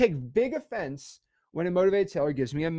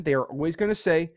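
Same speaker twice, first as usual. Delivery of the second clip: normal talking voice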